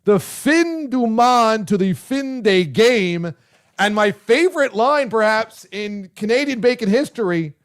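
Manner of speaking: ordinary talk, nothing marked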